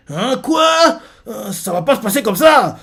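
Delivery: «Hein, quoi?» lancé très haut, puis colère; s'indigner d'être trompé; énervé. Retold or imitated. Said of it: "Luffy énervé". (Luffy énervé qui proteste très haut)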